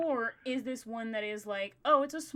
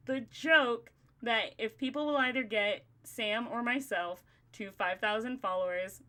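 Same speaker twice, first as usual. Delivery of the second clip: ordinary delivery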